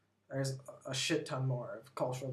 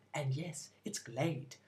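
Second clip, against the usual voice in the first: British ad voiceover; 2 semitones lower, 5 dB quieter, slower than usual. Retold or imitated. A vlogger mimics the quieter British Glade ad, softly. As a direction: hush the slogan down like the UK ad. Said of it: "Over here, it's".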